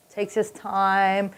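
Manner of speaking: very slow, soft and drawn out; dawdling. Retold or imitated. Storyteller shows a customer dawdling, very slow. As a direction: stretch every word lazily.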